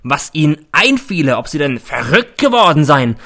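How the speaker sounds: loud, raging tirade; a principal performing fury at the boys; a loud theatrical rant; bellowing. A narrator's reading. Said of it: "brüllt er".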